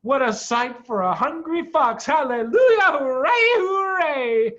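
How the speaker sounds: high, loud cheering; elated whooping; joyful calling. A narrator's reading. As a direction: cheer like it's a feast day. Fox calls cubs in joy.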